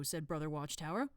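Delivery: plain reading voice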